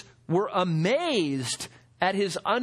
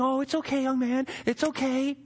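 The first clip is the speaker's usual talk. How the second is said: soothing, slightly higher reassurance; comforting a child; 4 semitones higher than usual. Pretend. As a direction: coax a crying boy gently. Someone comforting a crying boy.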